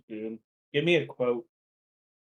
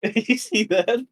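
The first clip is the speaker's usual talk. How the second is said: laughing; 8 semitones higher, 5 dB louder, slower than usual. Real, not acted. Laughing at misinput.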